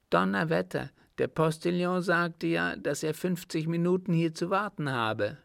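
high-pitched, indignant traveller; indignant Count Rallion. A narrator's reading. Stranded count protests about the postilion's promise, indignant.

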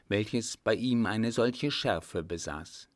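plain reading voice

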